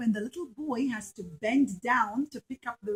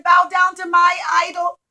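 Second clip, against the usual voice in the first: loud, higher shouted order; king shouting; 6 semitones higher, 9 dB louder than usual. Acted. King shouting at the third son, loud order. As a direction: shout the command at him.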